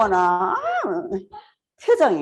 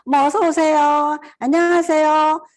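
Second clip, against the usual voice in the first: higher, drawn-out cheerful welcome; bright welcoming tone; 6 semitones higher, 4 dB louder than usual. Shown as a demonstration. 높은 톤으로 손님을 반기며 인사하기. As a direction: pour warmth into the welcome. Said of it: "썰톤으로".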